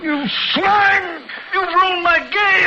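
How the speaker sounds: high, loud, raging scream; furious shout. Acted. Van Allen raging at the doctor who spoiled his game, furious shout. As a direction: scream it in blind fury.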